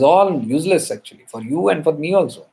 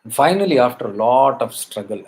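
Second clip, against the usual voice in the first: ordinary talk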